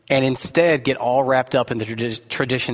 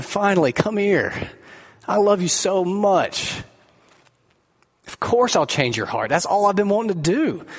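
higher, welcoming and overjoyed; tender relieved God; 5 semitones higher than usual. Pretend. God welcoming an honest sinner home, higher and tender. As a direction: open your arms with the voice, finally. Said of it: "No, he's gonna go".

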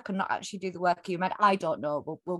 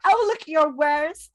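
high, slow bad American accent; 8 semitones higher, 4 dB louder, slower than usual. Podcaster mocking a precocious American eleven-year-old, bad American accent. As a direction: ham up a precocious American kid. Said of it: "that was the worst American accent ever".

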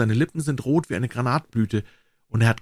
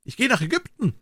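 raised-pitch announcement; calling out; 11 semitones higher than usual. A narrator's reading. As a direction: call it out high, like a small bird. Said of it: "rief der Vogel".

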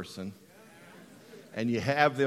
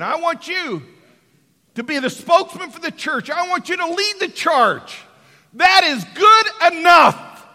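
preaching at full force; 13 semitones higher, 13 dB louder than usual. Acted. Jesus telling Peter to lead the church, shouted preaching.

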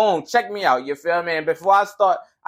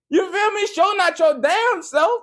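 laughing; 8 semitones higher, slower than usual. Real, not acted. Laughing after punchline.

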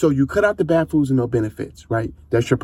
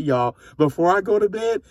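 ordinary delivery

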